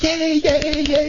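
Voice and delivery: high, giddy squeal of victory; a gleeful campy cheer, very high; squealing yay. Acted. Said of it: "He's like".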